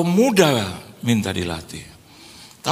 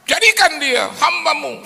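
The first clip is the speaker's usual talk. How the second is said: high, pleading prayer voice; mock prayer. Wife prays for her husband, high and pleading. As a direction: beg God in a high voice. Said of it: "dia doa".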